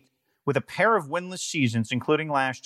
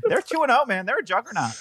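mock hype; 9 semitones higher, faster than usual. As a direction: hype it up like a fan. Mock hype of tiny school.

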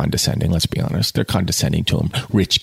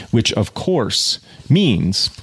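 ordinary delivery

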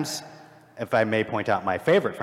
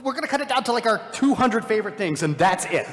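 much higher, gushing with excitement; overexcited self-quote; 10 semitones higher than usual. Retold or imitated. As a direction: play the fan who wants to keep everything. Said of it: "We're like".

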